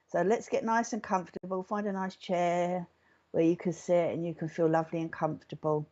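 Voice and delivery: soft, unhurried meditation voice; guided meditation. Real, not acted. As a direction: settle listeners gently, softening into the breath. Begins leading the meditation.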